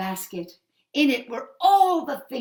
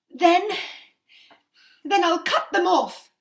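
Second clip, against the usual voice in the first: high and loud, halting then firm; desperate resolve; 8 semitones higher, 9 dB louder than usual. Acted. Mother telling the witch she will cut her feet off, desperately. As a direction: force it out in desperation.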